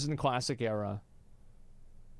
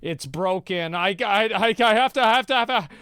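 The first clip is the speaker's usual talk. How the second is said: mock hysterical completionist; 6 dB louder than usual. Streamer mocking completionists who must get everything, hysterical mock panic. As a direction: parody a panicked player, stammering louder and faster.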